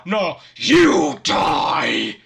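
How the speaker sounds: slow roaring battle cry; furious roar. Acted. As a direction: roar it in a rage. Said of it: "blood-curdling roar".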